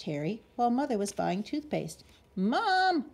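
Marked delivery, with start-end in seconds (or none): 2.3-3.1 high loud yell of Mom. A narrator's reading. Pinched boy yells for his mom. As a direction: yell for mother to come. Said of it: "Harry yelled".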